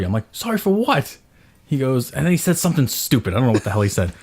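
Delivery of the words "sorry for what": high-pitched parental retort; retold exasperated dad testing an apology; angry challenge. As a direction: throw the apology back as a sharp question.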